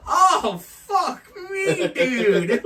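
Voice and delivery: high, loud crying whine; mock wail. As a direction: imitate him whimpering in shock. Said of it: "He goes".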